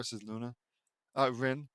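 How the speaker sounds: ordinary delivery, nothing marked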